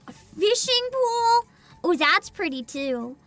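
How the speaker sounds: ordinary delivery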